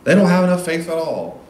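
hammed-up preacher scorn; mocking preacher. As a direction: scoff at the disciples from the pulpit. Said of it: "And that's when the preacher goes".

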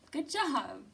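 ordinary talk

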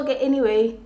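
ordinary talk

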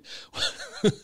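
soft breathy burst of laughter; real laughter. Real, not acted. Host cracking up while reading a question, real laughter. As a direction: break up laughing mid-sentence. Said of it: "I'm laughing".

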